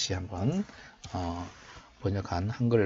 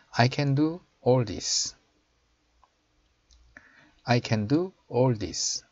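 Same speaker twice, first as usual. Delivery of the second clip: slow, raised teaching voice; slow model reading; 3 semitones higher, 3 dB louder, much slower than usual. Shown as a demonstration. Teacher showing learners the English verse, slow model reading. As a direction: read it out slowly for learners to copy.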